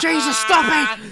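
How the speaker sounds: high, loud and slow protest; panicked victim voice. Retold or imitated. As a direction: cry it out like the victim. Acting out the victim.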